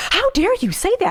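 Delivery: high, loud, hammed-up indignation; mock indignant outrage. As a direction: play the offended spouse, over the top. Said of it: "and you're like".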